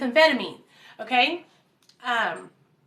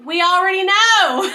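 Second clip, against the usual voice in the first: mock obvious; 7 semitones higher, 8 dB louder than usual. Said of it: "We were like".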